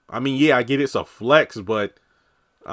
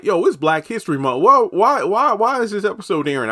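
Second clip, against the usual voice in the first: high, loud fans' protest; mock outcry; 9 semitones higher, 6 dB louder than usual. Fans reacting to a February episode, loud mock outcry. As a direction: act out incredulous fans, pitched way up.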